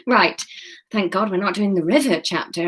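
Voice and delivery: usual speaking voice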